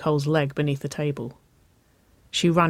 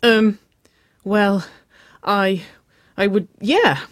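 higher, louder flustered stammer; halting and flustered, word by word; 5 semitones higher, 6 dB louder, slower than usual. A narrator's reading. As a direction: stumble over it, caught off guard. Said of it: "flustered state".

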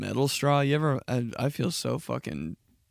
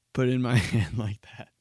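ordinary talk, nothing marked

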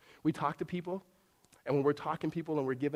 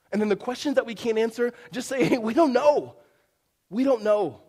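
raised, emphatic preacher's voice; forceful preaching; 8 semitones higher, 10 dB louder than usual. Real, not acted. Preacher admitting unanswerable questions to the congregation, forceful. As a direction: drive it home louder and higher.

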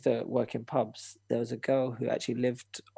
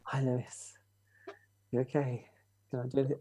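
put-on soft sweet girl voice; 5 dB quieter than usual. Girl constantly checking on him.

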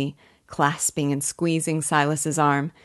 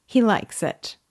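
pitched up high, light and playful; frisky and laughing; 7 semitones higher than usual. Acted. Eppie teasing her father about Aaron, high and playful. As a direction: tease it out, bouncing with glee. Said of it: "laughing and frisking".

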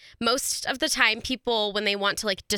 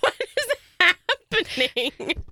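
confused; 9 semitones higher, slower than usual. Retold or imitated. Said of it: "I'd be like".

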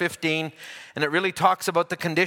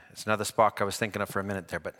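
quick aside; 10 semitones lower, 7 dB quieter, faster than usual. Real, not acted. Quick aside correcting himself.

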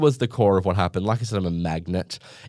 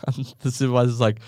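normal talking voice

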